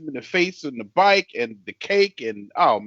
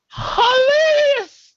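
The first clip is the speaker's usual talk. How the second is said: drawn-out hilarious; 6 dB louder, much slower than usual. Real, not acted. Raving to friends about the movie, stretched high. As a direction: stretch the word way up high and loud.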